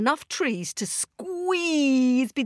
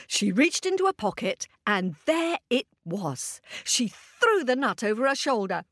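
plain reading voice

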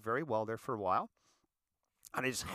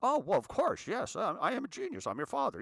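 hammed-up proud dad, higher and louder; mock boast; 9 semitones higher, 4 dB louder than usual. Dad jokingly bragging to his grown son, hammed-up proud. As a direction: play the genius father to the son.

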